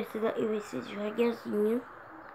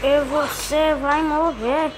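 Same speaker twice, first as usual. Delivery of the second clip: mock threat; 3 semitones higher, 7 dB louder, slower than usual. Taunting an enemy in the game.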